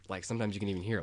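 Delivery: ordinary talk, nothing marked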